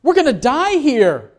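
high-pitched mock panic; acted fear. Frightened disciples to Jesus in the storm, acted panic. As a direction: wail it like a scared disciple. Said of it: "They're afraid, and they say".

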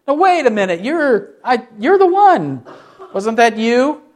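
suspicious accuser. Retold or imitated. Relative of Malchus challenging Peter, acted suspicious and high. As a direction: act out a sudden, accusing double take.

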